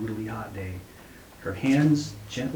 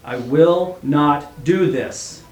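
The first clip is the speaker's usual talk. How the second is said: slow, loud, raised-pitch yell; yelled refusal; 5 semitones higher, 12 dB louder, much slower than usual. A narrator's reading. Father yelling his refusal to the judge, boiling angry. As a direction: hammer out each word in anger. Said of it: "Mac yelled, his blood boiling hot".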